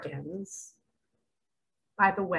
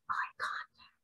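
whispered; 14 dB quieter than usual. Real, not acted. Hushed emphasis on eye contact.

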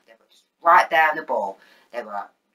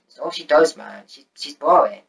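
normal talking voice

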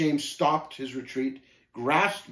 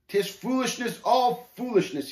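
high, faltering stammer; 10 semitones higher than usual. A narrator's reading. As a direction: stumble out the words, rattled. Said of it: "he managed to stammer".

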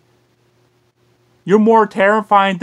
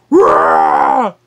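monster roar; 3 dB louder than usual. Pretend.

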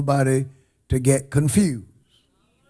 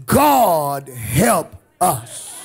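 ordinary talk, nothing marked

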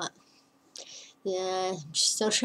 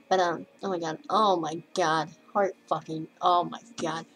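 ordinary delivery

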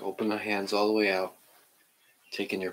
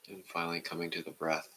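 guided meditation; 2 semitones lower, 4 dB quieter than usual. Acted. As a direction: ease the class into stillness, half whispering. Leads a seated meditation.

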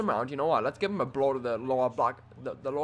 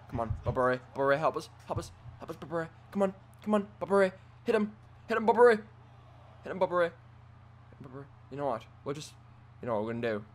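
usual speaking voice

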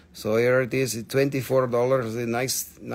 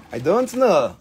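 pitched up with a big fall; exaggerated repeat; 3 semitones higher, 4 dB louder than usual. Seller repeats doubt to viewers, playfully exaggerated and high. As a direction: swoop up playfully and drop.

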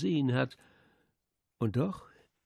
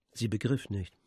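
ordinary delivery

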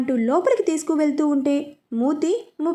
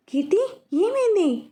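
a higher, startled question; worried, alarmed; 6 semitones higher than usual. A narrator's reading. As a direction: woken suddenly, anxious for her. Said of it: "అని కంగారుగా అన్నాడు". (కంగారుగా, ఆందోళనతో)

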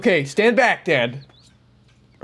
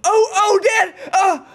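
excited yelling; 12 semitones higher, much slower than usual. Real, not acted. Kid yelling, dragging dog upstairs.

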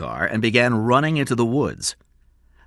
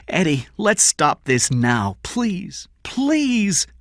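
pleading; 8 semitones higher, slower than usual. A narrator's reading. Kathy pleading with Eddie.

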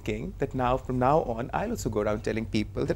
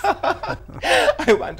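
laughing; 8 dB louder than usual. Real, not acted. Host laughs at the story.

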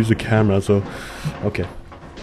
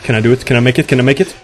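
anxious urgency; 2 semitones higher, 7 dB louder, faster than usual. Real, not acted. Racing away from pursuer.

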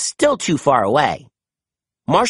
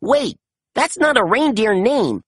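tag puzzled realization; 8 semitones higher than usual. A narrator's reading. Chase realizes his mistake.

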